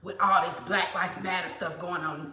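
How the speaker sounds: usual speaking voice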